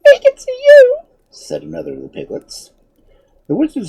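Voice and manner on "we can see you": very high, loud piglet squeal of a voice. A narrator's reading. Invisible piglet answering its master, squeaky and high. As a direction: pipe it up like a tiny piglet.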